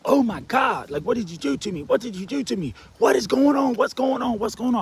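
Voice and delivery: high, loud panicked questions; panicked freakout. Retold or imitated. Waking from surgery with his arm dangling, panicked yelling. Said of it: "I freaked out".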